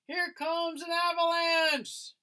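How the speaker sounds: high, loud warning cry; sudden alarmed cry; cried out. Acted. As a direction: yell a warning down the slope. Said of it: "someone cried".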